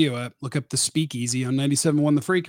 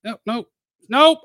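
ordinary delivery

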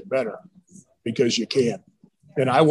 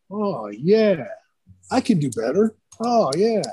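imitating eager player; 5 semitones higher, slower than usual. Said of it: "making them go".

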